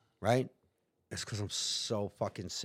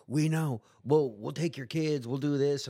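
threatening cop; 5 semitones higher, 5 dB louder than usual. Retold or imitated.

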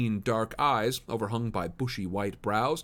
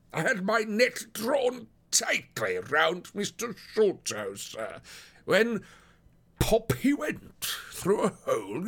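very high, drawn-out voice of a worried officer; humbled detective asking for help, pitched high; police detective, humbled; 11 semitones higher, slower than usual. Acted. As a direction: confess a baffling case in a slow, high-pitched character voice.

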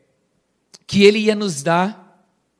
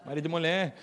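teasing warning; 2 semitones lower, 11 dB quieter, faster than usual. Retold or imitated.

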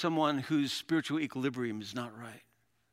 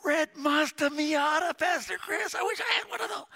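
mock dying believer; 4 dB louder than usual. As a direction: ham up an absurd last wish. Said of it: "I've never heard anybody say".

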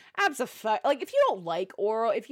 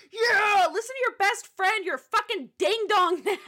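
cheering on; 9 semitones higher than usual. Retold or imitated. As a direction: egg her on like a fan. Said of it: "I was like".

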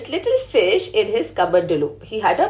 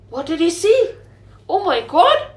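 high, loud startled gasp; storyteller amazed for the children; gasping surprise; 6 semitones higher, 4 dB louder than usual. A narrator's reading. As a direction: gasp as if you can't believe it. Storyteller gasps at grown fish.

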